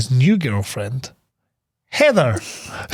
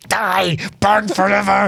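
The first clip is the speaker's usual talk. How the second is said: hammed-up curse, pitched higher; overplayed cry for damnation; mock condemnation; 5 semitones higher, slower than usual. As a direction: mimic an outraged wife damning someone. Wife's condemnation in retold scene.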